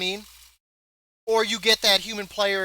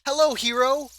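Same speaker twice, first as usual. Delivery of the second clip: mock generic NPC; 6 semitones higher, 3 dB louder than usual. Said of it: "is like".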